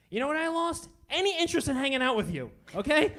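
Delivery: loud fast rising punchline; shouting punchline. Real, not acted. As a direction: yell the punchline at him.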